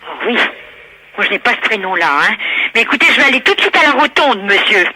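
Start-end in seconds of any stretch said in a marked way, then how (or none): none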